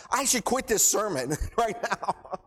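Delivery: real laugh breaking into the line. Real, not acted. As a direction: let a laugh escape mid-sentence. Laughs at his own tears.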